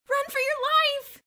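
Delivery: high, loud slowed panicked shout; panicked cry. A narrator's reading. Bruno shrieks at his friends to run. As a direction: scream the warning as you flee. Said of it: "he cried".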